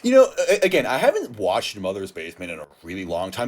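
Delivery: normal talking voice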